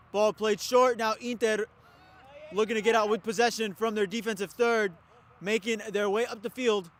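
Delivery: brisk live sports commentary; play-by-play commentary. Real, not acted. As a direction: call the match as it happens.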